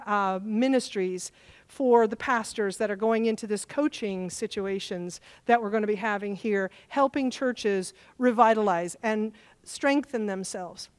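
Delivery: normal talking voice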